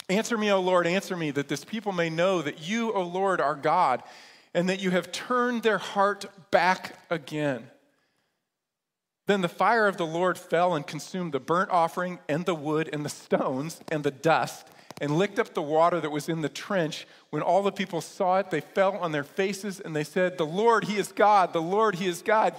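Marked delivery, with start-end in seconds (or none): 0.1-7.7 earnest prayer to heaven; fervent prayer. Acted. Elijah prays for fire. 20.4-22.5 crowd crying out, louder; loud proclamation. Acted. People fall down and proclaim.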